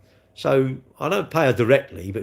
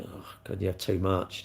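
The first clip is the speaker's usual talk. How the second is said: mock whispered thought; 3 semitones lower, 7 dB quieter than usual. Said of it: "And you just think".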